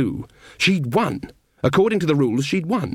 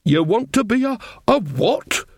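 put-on stern old witch; 10 semitones higher than usual. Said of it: "she said in that voice of hers".

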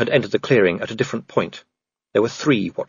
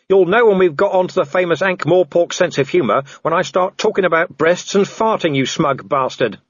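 raised-pitch angry retort; defiant Vimes; 7 semitones higher than usual. Acted. Vimes insulting the werewolf below, defiant high call. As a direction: call back a scornful insult. Vimes shouts back at Wolfgang.

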